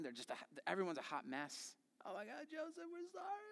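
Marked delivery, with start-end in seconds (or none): 2.0-3.4 high, soft, weepy apology voice; tearful apology. Retold or imitated. Brothers weeping their apology to Joseph, high and tearful. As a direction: sob out the sorry as the brothers. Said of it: "and they're crying".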